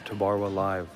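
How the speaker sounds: ordinary delivery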